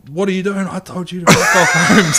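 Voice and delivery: high, loud imitated coach roaring; angry coach yell. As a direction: bark it like the furious coach. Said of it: "sought me out and was like".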